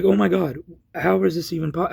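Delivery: retold outburst, loud and high then settling; exasperated disbelief. Man reacting to police denying the store, exasperated. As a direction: exclaim in helpless disbelief. Acted disbelief during arrest.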